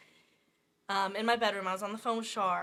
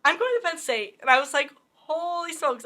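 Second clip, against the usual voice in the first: higher and louder proud declaration; excited announcement; 7 semitones higher, 9 dB louder than usual. Retold or imitated. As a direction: shout the big news to the family. Announcing commitment to parents.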